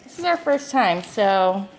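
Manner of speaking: talking with trays in; with whitening tray. Real, not acted. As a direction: teeth trays in mouth. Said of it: "so my voice is a little weird".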